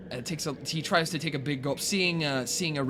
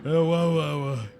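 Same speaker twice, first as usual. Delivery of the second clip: put-on slow slurred drunken mumbling of a name; drunken mumble; 4 semitones higher, 6 dB louder, slower than usual. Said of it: "like a drunken, like, mumbling of someone's name".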